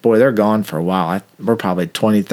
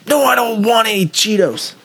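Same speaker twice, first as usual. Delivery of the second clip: high, whiny suspect voice; squeaky mock-indignant question; playing the irritated prisoner; mimicking the suspect; 13 semitones higher than usual. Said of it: "I said".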